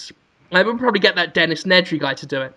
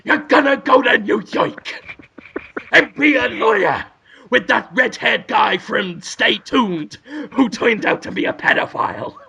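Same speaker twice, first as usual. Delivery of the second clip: Al Pacino impression; 3 semitones higher than usual. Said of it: "this is Al Pacino".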